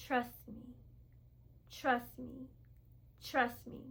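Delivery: soft, barely voiced divine whisper; still small voice. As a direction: hush it like a quiet inner voice. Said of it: "I heard a still voice tell me".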